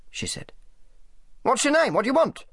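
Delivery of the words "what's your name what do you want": high-pitched, impatient questioning; brusque interrogation. A narrator's reading. Selby questions Sally in the office, brusquely demanding. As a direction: fire questions at an intruder.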